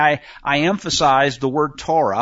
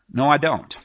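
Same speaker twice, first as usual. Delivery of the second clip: normal talking voice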